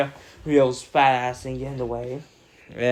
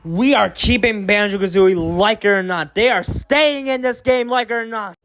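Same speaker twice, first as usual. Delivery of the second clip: defiant shout; 7 semitones higher, 5 dB louder than usual. Real, not acted. Defiant about keeping Banjo-Kazooie.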